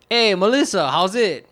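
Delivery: high, loud, slack-jawed Hawaiian pidgin; Moke pidgin greeting. Shown as a demonstration. Greeting a coworker back home, high loud Moke pidgin. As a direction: throw it out jazzy and lackadaisical in Moke pidgin. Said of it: "we were regular Moke people back there".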